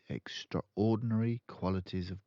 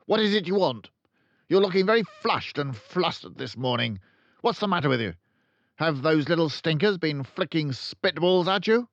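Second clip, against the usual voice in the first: scornful questions barked loud and high; Trunchbull barking; 8 semitones higher, 7 dB louder than usual. A narrator's reading. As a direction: headmistress sneering at a flustered teacher, barking. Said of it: "She either barked or shouted".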